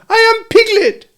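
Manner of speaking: very high, loud squeaky insistence; loud protest. A narrator's reading. Piglet insisting on his identity to Kanga, loud protest. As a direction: squeal out who you are. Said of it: "said Piglet loudly".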